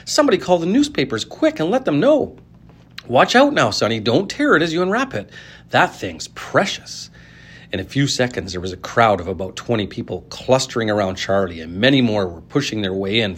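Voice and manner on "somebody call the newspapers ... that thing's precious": breathless with excitement; excited shopkeeper. A narrator's reading. Shopkeeper still shouting excitedly.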